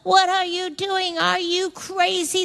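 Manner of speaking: high-pitched incredulous heckling; mock yelling. Neighbours heckling Noah as he builds the boat, mock yelling. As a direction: call up at him as a scoffing neighbour. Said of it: "they would yell up at him".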